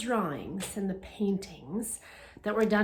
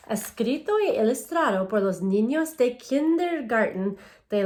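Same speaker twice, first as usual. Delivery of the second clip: louder, clear storybook reading to a class; reading to children; 6 dB louder than usual. Acted. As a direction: read each page out to the kids, bright and paced. Reads Spanish picture book aloud.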